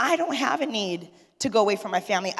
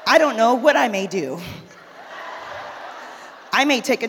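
airy, pointed mock indifference; sarcastic breezy reply to husband; petty mock nonchalance; 4 dB louder than usual. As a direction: play it cool to needle him. She answers husband with mock indifference.

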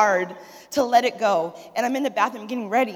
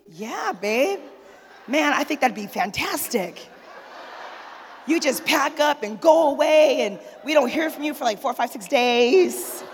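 mock eager husband urging her to go; playful imitation of the husband; husband's eager voice; 4 semitones higher than usual. Husband voiced in retold story.

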